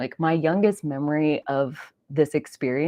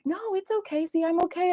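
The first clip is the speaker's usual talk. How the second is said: grandma soothing. Retold or imitated. Grandma insisting she is okay, high soothing voice. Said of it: "she's like".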